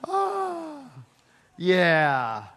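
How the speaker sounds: ordinary talk, nothing marked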